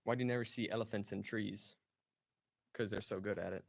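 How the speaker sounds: usual speaking voice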